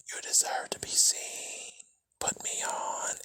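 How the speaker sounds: voiceless, breathy whisper; ghostly whisper. A narrator's reading. The mask tempting Isabel to wear it, ghostly whisper. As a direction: tempt her in a hushed ghost voice. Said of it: "and the whisper".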